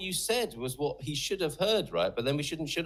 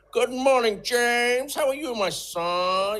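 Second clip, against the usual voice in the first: loud, high, drawn-out American caricature; W C Fields; 9 semitones higher, 5 dB louder, much slower than usual. Acted. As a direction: ham up an old American comic with stretched words. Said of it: "American is like W C Fields".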